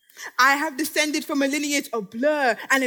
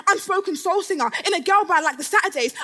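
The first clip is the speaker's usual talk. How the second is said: ordinary talk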